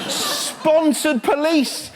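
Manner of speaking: drawn out, very slow, disbelieving; slow incredulous repeat. Real, not acted. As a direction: let each word hang in disbelief.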